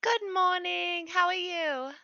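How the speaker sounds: sweet character voice. Acted. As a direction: answer brightly and sweetly. Player's character answers innkeeper.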